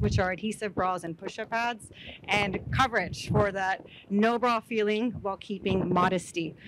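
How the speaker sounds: normal talking voice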